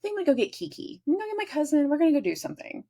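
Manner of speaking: put-on high breezy girlfriend voice. Girlfriend telling boyfriend her plans, high and breezy. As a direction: chirp out the plans lightly. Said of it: "I was like".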